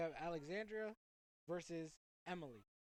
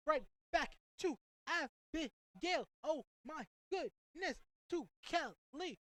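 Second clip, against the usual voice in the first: high, slow, louder drawn-out call; excited drawn-out call; 7 semitones higher, 5 dB louder, much slower than usual. Real, not acted. Commentator calling a fast passing run, high and stretched. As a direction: stretch the names out, thrilled. Thrilling pass sequence.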